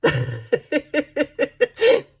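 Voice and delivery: giggling after a bad American accent; high laugh at herself. Real, not acted. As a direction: burst out laughing at your own accent. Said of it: "Sorry to all you Americans".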